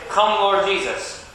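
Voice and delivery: slow, high-pitched call-out; loud call to response. Shown as a demonstration. Minister urges shy children to say it loudly. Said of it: "if you don't say it loudly".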